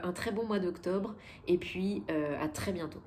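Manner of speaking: normal talking voice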